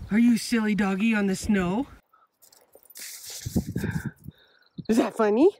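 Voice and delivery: loud, teasing questions to a pet; real playful pet-talk; playful dog talk. Real, not acted. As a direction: tease the dog playfully, voice lifted. Playfully talking to her dog.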